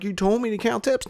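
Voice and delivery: a hint of a sulky employee; mock worker reply. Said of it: "He's like".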